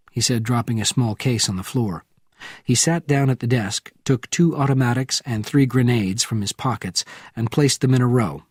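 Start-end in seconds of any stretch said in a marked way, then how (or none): none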